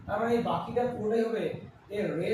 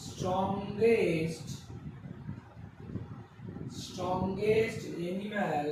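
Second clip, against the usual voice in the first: very slow, with long gaps between words; slow dictation; 4 dB quieter, much slower than usual. Shown as a demonstration. Teacher writing an example for students, slow dictation. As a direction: spell out the sentence word by word as you write.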